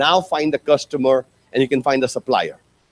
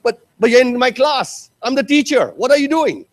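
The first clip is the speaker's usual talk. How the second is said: shocked teacher; 7 semitones higher than usual. Retold or imitated.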